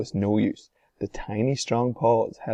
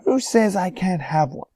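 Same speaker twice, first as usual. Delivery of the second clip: high, loud defiant shout; 9 semitones higher, 5 dB louder than usual. A narrator's reading. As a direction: shout back like a brat. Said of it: "shouted Veruca".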